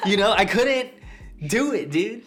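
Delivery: high, loud and emphatic, near laughing; real hyped-up excitement. Real, not acted. Husband admitting he couldn't do it, really hyped up. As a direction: insist on it, all worked up. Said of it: "Sorry, I'm getting so hyped about it".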